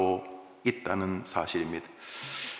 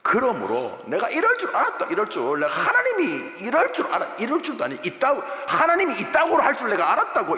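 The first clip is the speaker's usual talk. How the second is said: higher and louder, mocking God with a laugh; sarcastic I-knew-it rant; 10 semitones higher, 6 dB louder than usual. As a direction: spit it out like a bitter told-you-so. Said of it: "좋은 말인데 비아냥입니다".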